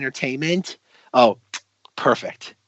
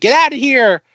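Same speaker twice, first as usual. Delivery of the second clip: high, raised mock warning; imitated urgent warning; 10 semitones higher, 4 dB louder than usual. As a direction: call it out high, as if shielding her. Imitates shopkeeper shielding her.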